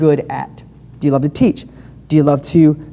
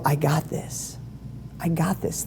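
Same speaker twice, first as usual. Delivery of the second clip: quiet self-assurance; 13 dB quieter than usual. Pretend. Imagined confident inner voice.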